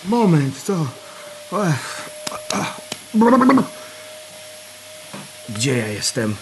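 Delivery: groggy hungover voice. Acted. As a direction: speak through a pounding headache. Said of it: "Łeb mi pęka".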